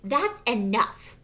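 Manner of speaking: a touch of stern mama; mild scolding. A narrator's reading. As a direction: scold it firmly, word by word. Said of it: "scolded Mama".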